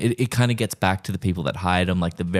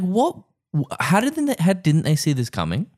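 high-pitched, stumbling disbelief; mock incredulous; 10 semitones higher than usual. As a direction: squeak out baffled disbelief, hammed up. Mock viewer reaction to the plot.

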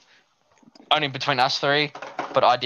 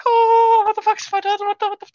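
very high squealed mock panic; hammed-up high-pitched panicked squeal; panicked little boy; 6 dB louder than usual. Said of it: "little boy was like".

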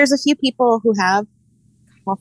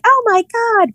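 excited; slower than usual. Retold or imitated. Quoting people excited about pumpkin spice.

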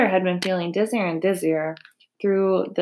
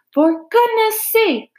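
very high, loud, stretched-out exclamation; exasperated high bellow; 13 semitones higher, 5 dB louder, slower than usual. A narrator's reading. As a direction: bellow it like someone just drenched. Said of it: "bellowed Mister Zuckerman".

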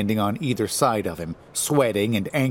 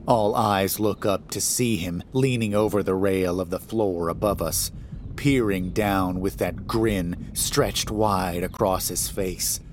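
ordinary delivery, nothing marked

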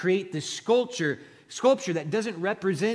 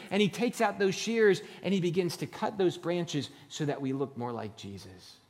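ordinary talk, nothing marked

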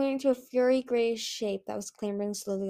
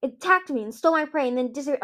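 fast, higher, indignant complaint; snarled; 5 semitones higher, 4 dB louder, faster than usual. A narrator's reading. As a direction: rattle off the grievance angrily. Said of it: "Winter snarled".

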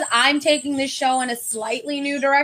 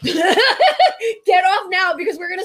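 laughing, louder, slowed down: get off now; laughing warning; 5 semitones higher, 5 dB louder, slower than usual. Real, not acted. Telling a viewer to leave before spoilers, laughing.

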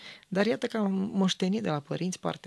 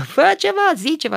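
high, loud scolding shout; retold yell; 11 semitones higher, 12 dB louder than usual. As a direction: yell it like an angry producer on set. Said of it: "a țipat la mine".

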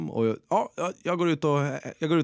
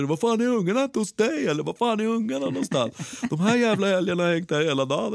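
shouting about moose; 9 semitones higher than usual. Retold or imitated. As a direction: bellow it to the neighbour. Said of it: "börjar skrika om älgar".

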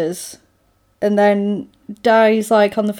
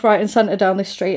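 normal talking voice

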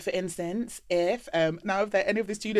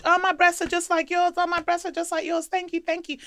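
much higher, loud gushing fan; high excited fan; 13 semitones higher, 7 dB louder than usual. Retold or imitated. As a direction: squeal it like a thrilled fan. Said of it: "I would get a message".

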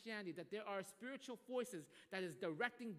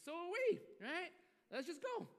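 pushy coworker; 9 semitones higher than usual. Retold or imitated. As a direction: brush the excuse off, cocky. Coworkers pressing again.

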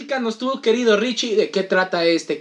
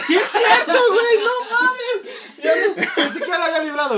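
high, laughing disbelief; shocked laugh at bad news; surprised; 12 semitones higher than usual. Real, not acted. Realizes he lost after all.

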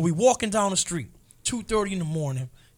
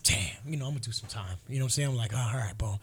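resigned; 7 semitones lower, 6 dB quieter, faster than usual. Retold or imitated. Resigned thoughts in jail.

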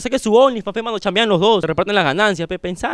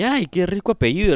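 normal talking voice

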